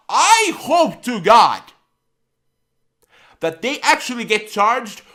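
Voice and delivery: loud, slow and fervent; slow, loud "I hope to God" from real anger; really worked up, almost shouting; heated plea. Real, not acted. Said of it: "getting so worked up".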